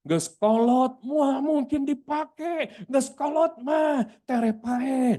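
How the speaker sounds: high, drawn-out Sundanese naysayer; mock dismissive onlooker, words stretched out; voice of public opinion. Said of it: "Itu opini umum".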